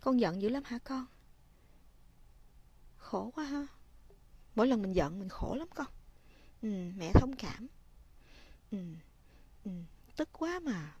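put-on soft, gentle comforting to an angry child; soothing mother. Shown as a demonstration. Mother softly comforting her angry child. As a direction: murmur sympathy softly, like a mother. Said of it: "Mình nói".

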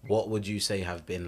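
usual speaking voice

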